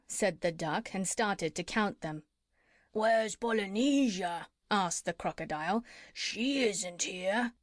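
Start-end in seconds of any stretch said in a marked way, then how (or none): 2.9-4.5 slow, drawn-out crocodile voice; a lightly voiced crocodile asking after the parrot. Crocodile asking the others where Polynesia is, slow creature voice. 6.1-7.5 very slow creature voice; a lightly voiced crocodile, drawn out. Crocodile telling the doctor she is gone, slow creature voice.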